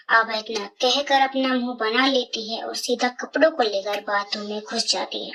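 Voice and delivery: ordinary delivery, nothing marked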